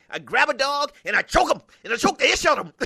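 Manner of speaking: high-pitched movie-dad rant, laughing by the end; Pops from Friday. Acted. Host quoting film dad to co-host, playful imitation. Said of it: "like Pops from Friday".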